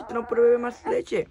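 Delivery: loud and high, scolding over the crying; loud mock scolding. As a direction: lament loudly to the fussy baby. (regañando al bebé en voz alta y aguda)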